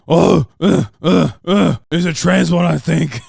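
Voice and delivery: loud dopey mock Joe voice; mocking Joe Rogan. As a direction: ham up a dim, stammering Joe. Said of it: "immediately was like".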